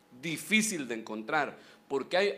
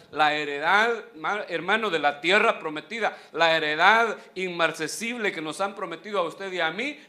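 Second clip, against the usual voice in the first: building pulpit emphasis on "heredad"; preaching; 6 dB louder than usual. Acted. Pastor promising the inheritance, rising preaching. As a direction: swell toward the promise.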